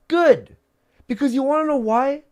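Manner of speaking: high, loud and defiant; rising up loud against the doubters; defiant outburst. Real, not acted. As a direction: throw it back at the critics. Defiant rant about the critics.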